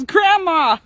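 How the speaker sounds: put-on loud, high gleeful little-kid call; imitating delighted toddler. Granddaughter spotting her grandma on YouTube shorts, imitated as a gleeful shout. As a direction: call it out like a laughing two-year-old. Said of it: "and she's laughing. She goes".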